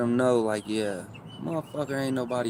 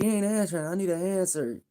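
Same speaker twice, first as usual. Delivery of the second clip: much higher, mock boss voice; imitating the boss; 8 semitones higher than usual. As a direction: voice the boss on the phone. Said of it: "he was like".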